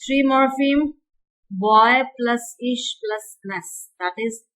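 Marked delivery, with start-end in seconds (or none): 1.5-3.9 slow. Shown as a demonstration. Teacher splitting word into morphemes.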